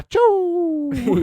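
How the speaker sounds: high, loud, slow drawn-out achoo; imitated loud sneeze. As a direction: stretch out a booming fake sneeze. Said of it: "And, uh".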